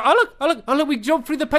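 excited discovery. Real, not acted. Spots paintings like in game.

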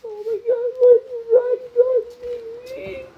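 whimper, close to crying; terrified whimper. Real, not acted. Terrified, Freddy approaching.

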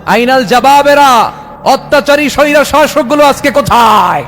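high-pitched ringing summons; calling out. Acted. Allah asking the earth where the tyrants are, calling out. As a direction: demand answers in a rising preacher's cry. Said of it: "পৃথিবীকে একটা ডাক দিবেন".

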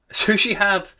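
high, loud burst of agreement; crying out. A narrator's reading. Scrooge answering the ghost, crying out. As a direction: cry it out, pitched well up. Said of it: "cried Scrooge".

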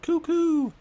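high mock owl hoot; owl call. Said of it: "owl is like".

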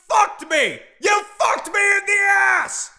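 hammed-up tantrum rant; furious shout. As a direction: scream it like you were betrayed. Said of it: "I think I lost my cool".

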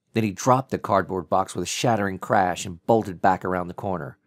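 ordinary delivery

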